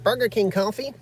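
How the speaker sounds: imitating a coffee snob turning up their nose; snobby disgust. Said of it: "everybody who goes".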